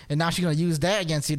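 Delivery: usual speaking voice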